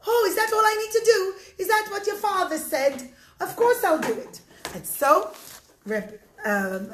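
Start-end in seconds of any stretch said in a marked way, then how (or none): 0.1-4.4 higher, louder, eager agreement; eager relief. Acted. Moshe hears all he must do, eagerly relieved. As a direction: jump at the offer, relieved.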